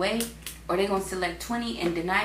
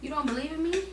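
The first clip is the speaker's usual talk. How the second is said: sassy God; 8 semitones higher, 6 dB quieter than usual. Retold or imitated. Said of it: "Basically, he's saying".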